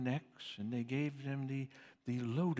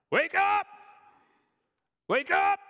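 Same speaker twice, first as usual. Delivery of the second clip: high, loud alarm cry; shouting; 14 dB louder than usual. Retold or imitated. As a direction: shout it to wake sleepers. The cry, wake up.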